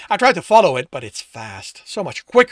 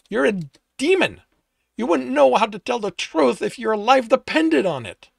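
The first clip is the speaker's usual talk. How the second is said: loud, higher, furious accusation; angry shout; 10 semitones higher, 8 dB louder than usual. Acted. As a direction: yell it at the demon in contempt. Hunter shouting at demon.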